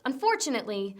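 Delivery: normal talking voice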